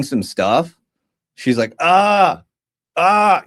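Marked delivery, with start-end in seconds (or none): 1.8-3.4 retold sharp cry of pain, high and loud; short mock pain yelps. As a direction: imitate her yelping as it hurts. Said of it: "it's like it hurt".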